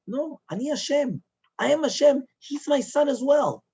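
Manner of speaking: high, forceful retold proclamation; emphatic divine declaration. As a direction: declare it with impassioned authority. God's emphatic answer, acted out.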